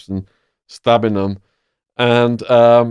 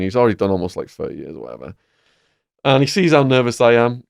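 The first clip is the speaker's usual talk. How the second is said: ordinary delivery, nothing marked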